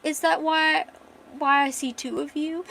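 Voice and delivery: put-on high, giggly drunk girl; tipsy girl voice. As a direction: play her dizzy and drunk. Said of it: "She's like".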